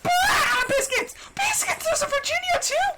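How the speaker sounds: high, fast panicked yelling; panicked shouting. Real, not acted. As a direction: shriek it in a panic. Panicked reaction to enemy appearing.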